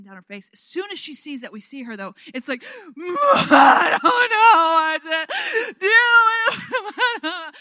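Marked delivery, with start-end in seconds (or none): 3.0-7.5 high, loud, slow sobbing wail; sobbing girl. Retold or imitated. Daughter sobbing because she cannot calm baby brother. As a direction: wail it through tears and a laugh. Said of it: "she's sobbing".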